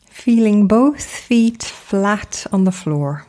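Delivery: guided meditation. Acted. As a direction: gently guide them into stillness, unhurried, phrase by phrase.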